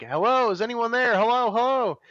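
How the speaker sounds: high, uneasy call of hello; calling into cave. Retold or imitated. Hiker calling to whoever is in the cave, high and nervous. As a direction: call hello into the dark, half unsure.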